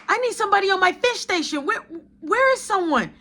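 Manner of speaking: high, fast mock-call across the kitchen; high hurried shout-out. Manager calling out in kitchen.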